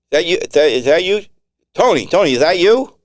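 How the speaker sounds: high, loud eager questions; excited recognition. Acted. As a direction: call out to Tony, thrilled to hear him. Excited recognition over radio.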